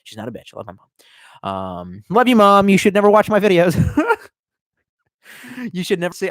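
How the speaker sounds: ordinary talk, nothing marked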